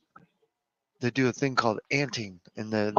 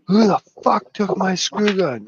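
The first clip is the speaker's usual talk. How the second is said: higher, louder indignant yell; hammed-up accusing shout; mock outrage at work; 7 semitones higher, 6 dB louder than usual. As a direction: holler like an annoyed worker missing a tool. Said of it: "It's like me at work".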